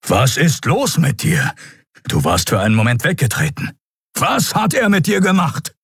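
agitated demand, pitch climbing sharply; Keru, polternd. A narrator's reading. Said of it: "polterte hinter ihr Kerus Bassstimme". (aufgebracht polternd, Stimme steigt)

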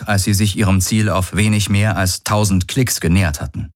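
ordinary delivery, nothing marked

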